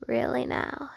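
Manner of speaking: soft, slow, drowsy tease; sleepy teasing murmur. Acted. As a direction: murmur a lazy threat.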